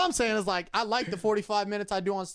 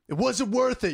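higher dramatic call; mock dramatic cry; 6 semitones higher, 4 dB louder than usual. Man calls after imaginary girl, mock dramatic. Acts shouting out the door.

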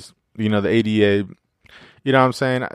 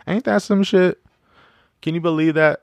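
disbelief; 8 semitones higher than usual. Real, not acted. Reacting in disbelief.

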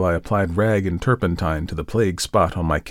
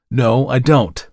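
louder, curt angry denial; said angrily; 4 semitones higher, 6 dB louder, slower than usual. A narrator's reading. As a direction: snap back in irritation. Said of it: "I said angrily".

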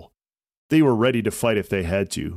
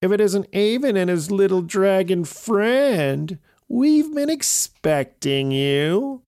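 very high, loud gloating witch; 11 semitones higher, 4 dB louder, slower than usual. A narrator's reading. Lead witch catching the heroes, high gloating. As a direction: savor each well like a wicked witch. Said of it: "said the lead witch".

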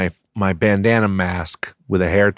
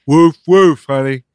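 dog barking impression; 4 semitones higher, 7 dB louder than usual.

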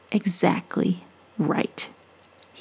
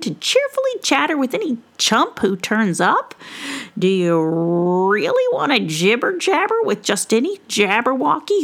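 high, playful witch voice; teasing witch; 5 semitones higher than usual. Acted. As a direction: tease him merrily. Witch teasing tongue twisters.

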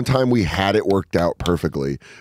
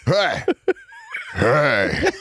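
mock meth-head caller; 4 semitones higher than usual. Meth head caller yelling on radio.

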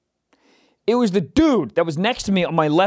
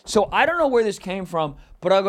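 ordinary talk

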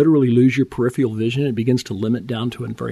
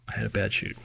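much softer, letting it sink in; quiet repeat; 3 semitones lower, 10 dB quieter than usual. Real, not acted. Echoing his own words to the listener, hushed and sombre. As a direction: drop to a hushed echo of the line. Repeating the bad chute, sinking in.

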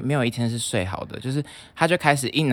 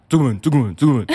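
lightly voiced gruff roommate, louder and curt; blunt roommate; 2 semitones higher, 6 dB louder than usual. Stern roommate bluntly answering where he's from. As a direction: bark the answer back flatly.

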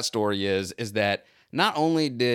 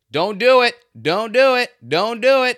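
loud retold yell, much higher; yelling warning; 12 semitones higher, 10 dB louder than usual. Narrator warning the shop owner to stop talking, retold yell. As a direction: bark the warning repeatedly at full volume. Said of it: "and I'm like".

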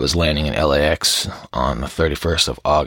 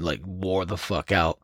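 usual speaking voice

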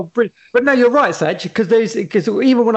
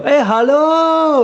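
drawn-out, slow, higher singer's call; Gujarati singer call; 7 semitones higher, much slower than usual. Retold or imitated. Garba singer calling out to the dancing crowd, drawn-out and higher. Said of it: "that famous Gujarati call that they do".

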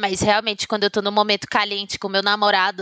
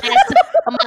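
squeaky laugh breaking through the word; can't hold the laugh in; laughing burst; 6 dB louder than usual. Real, not acted. Friends recalling a funk title, cracking up laughing.

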